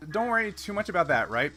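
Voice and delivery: ordinary talk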